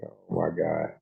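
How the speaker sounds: usual speaking voice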